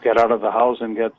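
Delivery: ordinary talk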